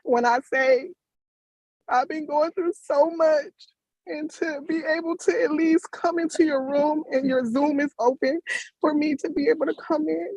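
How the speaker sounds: thanking her while choking up; tearful. Real, not acted. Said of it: "I'm sorry I'm getting emotional".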